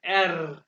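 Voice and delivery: "एर" loud, with the tongue vibrating on the r; rolled R. Shown as a demonstration. Teacher shows the German letter R, "एर" with rolled r. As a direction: trill the end of "एर". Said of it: "जुबान को vibrate करना है".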